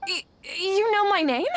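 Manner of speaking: stammered, high and loud; shocked stammer; startled. Acted. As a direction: caught off guard, stumbling on the first word. Startled stranger knows her name.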